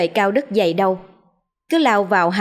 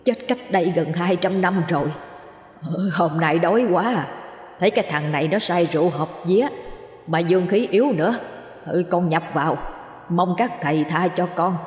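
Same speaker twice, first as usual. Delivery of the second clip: slightly lowered, quavering spirit voice; gruff, trembling ghost. Acted. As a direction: plead timidly as a captured ghost. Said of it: "giọng ồm ồm, run run".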